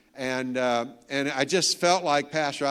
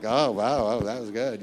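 lower, half-hearted "Oh, wow" of an unimpressed crowd; mock lukewarm crowd; 4 semitones lower than usual. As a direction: tease them with faint, limp praise. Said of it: "They clap like".

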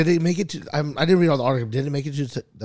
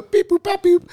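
robot beeps; 6 dB louder, slower than usual. Retold or imitated. Imitating submarine console noises.

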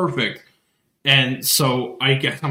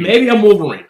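higher, louder jokey exclamation; joking outburst; 8 semitones higher, 8 dB louder than usual. Real, not acted. Guest joking why scanners flag tattoo, loud. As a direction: toss it out as a joke.

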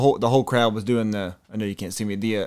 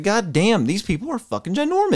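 amazed; 9 semitones higher than usual. Retold or imitated. Retold amazement at player size.

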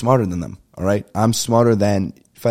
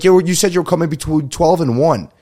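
retold complaint; 8 semitones higher, 4 dB louder than usual. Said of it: "It's like".

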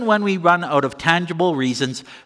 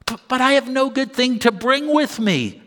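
pleading sinner; 8 semitones higher than usual. Retold or imitated. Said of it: "say you".

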